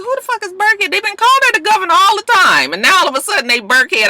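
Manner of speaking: very high, baffled exclamation; high incredulous what-the; confused outburst. Retold or imitated. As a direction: squeal in disbelief. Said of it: "I said".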